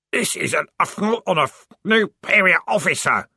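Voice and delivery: high, loud muffled screaming. Acted. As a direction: scream while held by the head. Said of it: "screamed the corporal in a muffled voice".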